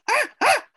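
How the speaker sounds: high loud monkey "ah ah" shrieks; monkey screech. Retold or imitated.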